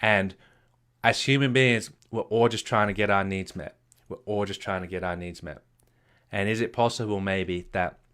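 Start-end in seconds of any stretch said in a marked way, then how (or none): 4.1-5.6 softer and lower repeat of the line; a quiet, reflective restatement for emphasis; soft echo. Shown as a demonstration. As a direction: let the repeat sink in quietly. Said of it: "repeated softer".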